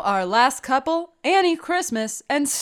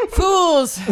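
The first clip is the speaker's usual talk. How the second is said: villain gloat; 6 semitones higher, 4 dB louder than usual. Acted.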